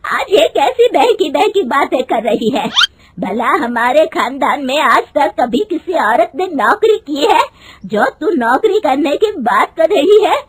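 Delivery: put-on indignant shouting old woman; angry scolding. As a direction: rage at her for wanting a job. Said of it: "उसकी सास गुस्से में कहती है".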